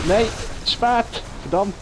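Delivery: sudden high frustrated cry; frustrated outcry. Real, not acted. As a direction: yell at the missed jump. Missed jump in game.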